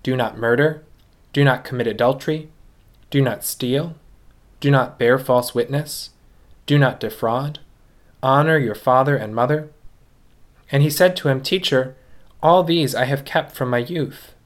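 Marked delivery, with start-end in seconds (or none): none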